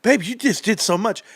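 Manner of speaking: lightly voiced wife, a touch of awe; mock impressed wife. Wife praises husband's grout cleaning, lightly impressed. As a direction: play the wife, gushing a little over the cleaning. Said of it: "and be like".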